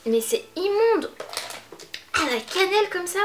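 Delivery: disgusted. Real, not acted. Disgusted by cinnamon candy.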